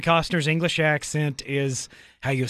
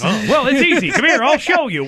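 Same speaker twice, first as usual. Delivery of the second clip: playful mock eagerness; 9 semitones higher, 6 dB louder than usual. Playful chimney sweep role.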